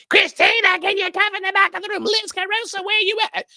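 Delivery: very high, loud calling across the room; mock yell. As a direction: holler for staff from the stage. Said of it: "I didn't have to yell, have to scream".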